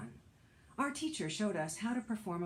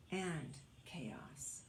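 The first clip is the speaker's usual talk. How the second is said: whispered, breathy and hushed; hushed whisper for effect; 9 dB quieter than usual. Acted. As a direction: drop to a whisper on the last word. Whispered title word contrast.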